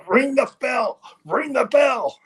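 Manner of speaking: high urgent yell; Vince yelling. Retold or imitated. Boss at ringside yelling at the timekeeper, frantic. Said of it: "Vince is yelling out".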